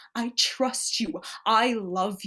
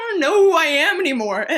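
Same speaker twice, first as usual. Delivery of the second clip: fast, higher, louder cry of confusion; anguished outburst; 6 semitones higher, 7 dB louder, faster than usual. Acted. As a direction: let it burst out fast.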